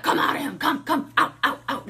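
loud, high rapid-fire commands; mock shouted exorcism. Imitating street deliverance on a demon, shouted. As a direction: bark the demon out, hammed-up. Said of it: "be like".

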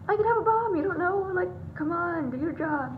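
high-pitched indignant outburst, easing slightly; retold upset. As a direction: protest in disbelief at careless staff. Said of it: "I was kinda upset".

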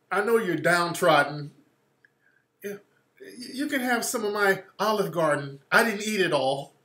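mildly patronizing mock offer; condescending favor. Handing leftovers to the downtrodden, lightly condescending. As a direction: talk down as if doing them a favor. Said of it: "they say that shit like they're just doing you a favor".

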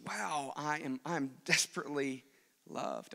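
soft, awed near-whisper dropping away; hushed wonder. Pretend. Preacher voicing a believer's awed response, hushed. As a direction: let the words fall quiet in amazement. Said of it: "it should make us just stop and say".

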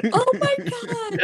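high, drawn-out laughing exclamation; laughing amazement. Real, not acted. Guest reacting to the host's story, laughing amazement. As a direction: squeal it slowly through a real laugh.

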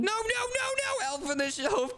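very high frantic nos; panicked pleading. Acted. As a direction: beg in panic. Pleading with the elf.

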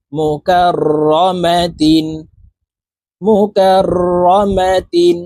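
drawn-out Quranic recitation, syllable by syllable; slow model recitation. Shown as a demonstration. Teacher reciting the assembled word for learners, slow chant. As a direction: stretch each syllable evenly, half-chanted.

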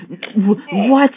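very high, loud disbelieving yelp; shocked shout. Real, not acted. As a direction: yelp it as you can't believe it. Falls again in parkour game.

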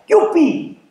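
high, gleeful whoop; playful cheer. Acted. Replacement father waking the children, cheering.

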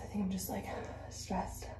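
hushed, breathy confession; whispering. Real, not acted. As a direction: drop to a whisper, stressed. Confiding her stress, voice drops.

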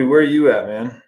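ordinary talk

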